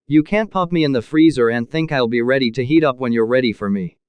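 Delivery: usual speaking voice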